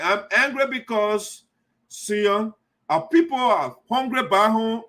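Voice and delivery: ordinary talk, nothing marked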